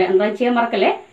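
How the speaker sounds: ordinary delivery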